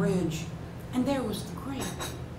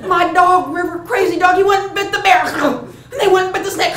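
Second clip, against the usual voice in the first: high, loud, frantic storytelling; excited climax; 9 semitones higher, 10 dB louder than usual. A narrator's reading. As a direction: blurt out the dog's crazy attack. Tells the climax of the fight.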